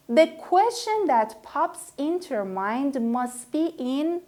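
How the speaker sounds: ordinary delivery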